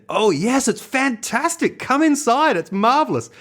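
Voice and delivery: high-pitched, gushing American welcome; rich American accent. Retold or imitated. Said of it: "rich American accent".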